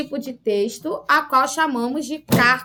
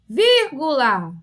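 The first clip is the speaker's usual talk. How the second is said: slow "Vírgula" in syllables, first one strongest; stress on VÍR; slower than usual. Shown as a demonstration. Teacher showing where the accent goes, slow "Vírgula" stressed on VÍR. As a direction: stretch VÍR, let "gu-la" fall away. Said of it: "quando eu separo as sílabas".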